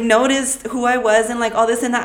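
normal talking voice

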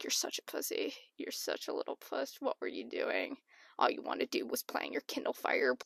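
quiet, nearly whispered telling-off; hushed self-scolding. Acted. Talking to her fifth grade self, hushed scolding. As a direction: scold yourself under your breath.

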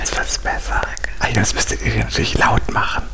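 unvoiced whisper right in the ear; ASMR whisper. Shown as a demonstration. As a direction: breathe it close into the mic. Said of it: "Du musst dabei flüstern". (ASMR-Flüstern direkt ins Ohr)

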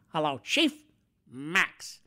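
lightly voiced put-on greeting; a hint of a goofy character voice; character impression. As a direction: deliver the one catchphrase in a borrowed voice. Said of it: "that's all I can do is".

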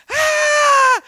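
sudden high piercing shriek of fright; a loud, shocked yelp; woman's startled scream. Retold or imitated. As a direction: scream as if you've just seen someone at your gate.

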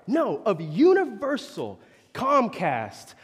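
mock haughty customer. Preacher voicing the guest's haughty reply. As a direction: talk down like an entitled guest. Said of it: "He goes".

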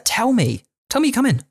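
high-pitched acted protest; exasperated plea. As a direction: plead high, as if to unannounced visitors. Acts out protest to unannounced parents.

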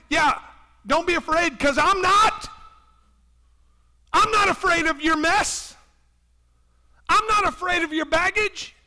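loud, high, ringing reassurance; fervent preaching shout; bold Jesus. Pretend. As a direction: preach it like Jesus calling Peter. Jesus answers Peter's excuses.

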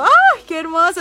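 loud, high, thrilled cry; overjoyed exclamation; delighted squeal. Real, not acted. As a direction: gush with real delight at the fan. Fan recalls photo together.

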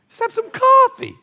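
high, loud, playful squeal; hammed-up excitement. Shown as a demonstration. Pastor joking with his church, high playful squeal. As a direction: ham it up for fun. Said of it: "just having too much fun".

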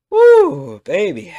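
very high loud whoop; excited whoop. Real, not acted. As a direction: whoop it out with glee. Excited exclamation.

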